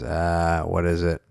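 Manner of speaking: quiet, low-key and hesitant; Smigel voice. Retold or imitated. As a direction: mumble it softly, unsure. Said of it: "And he goes".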